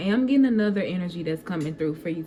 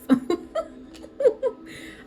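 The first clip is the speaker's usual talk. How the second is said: kind gentle laugh. Real, not acted. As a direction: laugh lightly and kindly. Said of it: "I'm laughing at the energy".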